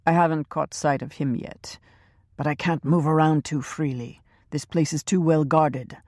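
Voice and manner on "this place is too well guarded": usual speaking voice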